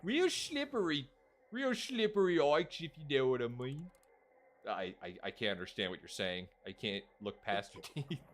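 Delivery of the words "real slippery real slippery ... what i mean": high, hammed-up British character; put-on British accent. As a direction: overplay a shady British character, pitched up. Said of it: "I'm trying to do a British accent".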